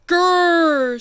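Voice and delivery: playful mock growl from a big beast; hammed-up animal grrr; rhino growl. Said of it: "said the rhinoceros".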